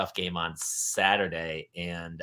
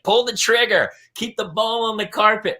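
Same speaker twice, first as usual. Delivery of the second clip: high, raised sideline holler; coach calling out; 11 semitones higher, 5 dB louder than usual. Retold or imitated. Soccer coach using his jargon on young players, calling out. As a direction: call instructions out to the players.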